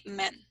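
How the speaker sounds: "man" with a vowel like Spanish E. Shown as a demonstration. Teacher repeats the example, E-like vowel in "man". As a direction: say "man" close to "men". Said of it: "the Spanish E".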